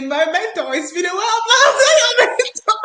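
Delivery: high, loud mock voice of the guy; imitating the guy. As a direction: ham up his cheeky message. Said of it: "He will write me and say".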